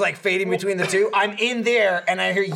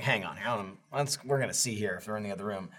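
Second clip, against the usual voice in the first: low hushed voice; 10 semitones lower, 12 dB quieter than usual. Retold or imitated. Coworker quoted checking the room.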